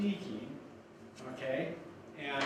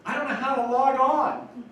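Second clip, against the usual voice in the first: high, loud admission in a put-on voice; imitating friend; 9 semitones higher, 10 dB louder than usual. Friend admits he can't log on, high put-on voice. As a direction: belt out the confession in his friend's voice. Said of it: "And he goes".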